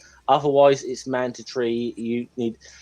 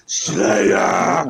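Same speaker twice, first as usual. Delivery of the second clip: drawn-out shout, pitched up; imitated metal-fan yell; 4 semitones higher, 4 dB louder than usual. Repeating the comedian's routine to friends, metal-fan yell. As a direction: holler the band's name like a concertgoer.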